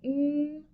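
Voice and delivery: a long hummed mm; mm sound. Shown as a demonstration. Teacher modelling the M sound, a hummed mm. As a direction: hum the mm with closed lips. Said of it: "Say it with me".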